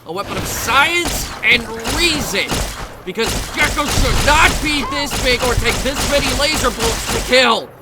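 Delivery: loud, high-pitched heroic ranting; mock-heroic shouted speech; hammed-up battle cry. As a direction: declaim it over gunfire, building to a yell. Hammy battle speech while firing.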